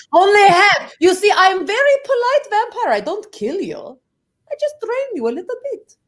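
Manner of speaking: high-pitched, pleading Russian vampire; Russian-accented vampire. Pretend. Very polite vampire reassures the listeners, high Russian accent. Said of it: "Your Russian accent".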